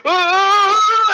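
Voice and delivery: long, high, loud "aah"; mock scream. Hero at the speed of sound, mock scream. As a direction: scream like you're hurtling along. Acting Junie in wind tunnel.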